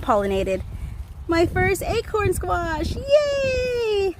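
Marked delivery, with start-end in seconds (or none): 1.3-4.2 high squealing yay; thrilled little cheer; excited. Real, not acted. Gardener tells viewers about her first squash, high thrilled cheer. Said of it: "So excited".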